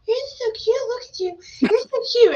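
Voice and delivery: put-on very high baby-talk coo; squeaky, gushing child voice; Selah cooing. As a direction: mimic a little girl adoring a tiny critter. Imitates daughter cooing at spider.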